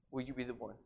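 very soft, low, tender question; loving appeal. Retold or imitated. Preacher voicing God's loving call to the congregation, softly. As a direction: drop to a gentle hush to ask. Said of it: "so faithfully and so lovingly says".

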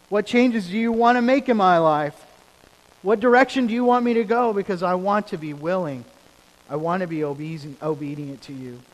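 loud, high, pleading prayer to God; fervent preaching prayer. Acted. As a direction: pray it out with full fervor. Preaching the prayer at full force.

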